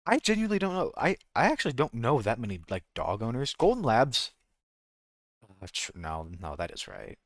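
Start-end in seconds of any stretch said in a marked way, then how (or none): none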